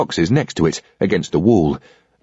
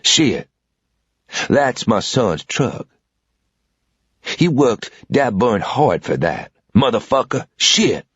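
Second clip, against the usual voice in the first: put-on old southern man; slower than usual. A narrator's reading. Old man holding a revolver on the intruder, slow drawl. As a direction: drawl it out, cool and unhurried.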